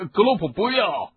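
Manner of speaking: high, loud shout. A narrator's reading. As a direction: yell a sharp warning. Hagrid yelling at giant.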